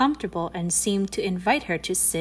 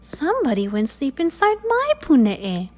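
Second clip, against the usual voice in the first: higher, sweet mama mongoose voice; soft, sweet voice; 6 semitones higher, 4 dB louder than usual. A narrator's reading. Mama Mongoose finds her bed slept in, sweet and higher. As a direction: say it sweetly, lifted above your usual pitch. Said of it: "said in her soft, sweet voice".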